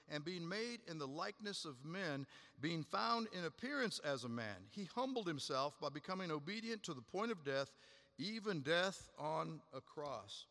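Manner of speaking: lifted, earnest reading of scripture. Acted. Preacher reading the hymn to the church, solemn and lifted. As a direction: read the verses out with weight, slowing at the end. Reads Philippians passage aloud.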